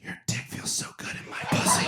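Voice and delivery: breathy whisper in her ear. Retold or imitated. Husband whispering in wife's ear, intimate whisper. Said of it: "I whispered in her ear".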